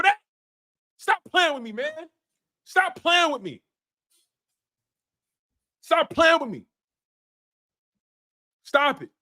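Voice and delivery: high, pressing scold; angry scolding. Real, not acted. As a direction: berate him for failing. Scolding the overpaid quarterback.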